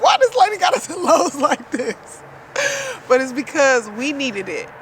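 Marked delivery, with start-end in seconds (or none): 0.0-2.2 higher, louder, half-laughing disbelief; tearful laughing exclaim. Retold or imitated. In the store with her husband, crying and laughing in disbelief. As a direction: marvel through tears and laughter. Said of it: "I'm like".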